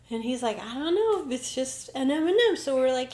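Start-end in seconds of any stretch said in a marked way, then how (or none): 0.6-2.6 mock puzzled therapist. Therapist's answer retold.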